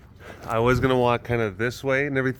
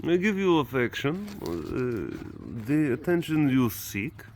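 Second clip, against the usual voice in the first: higher mock Russian accent; 4 semitones higher, slower than usual. Vlogger voicing the cat, in a mock Russian accent. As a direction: purr it like a Russian seductress. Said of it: "in a Russian accent".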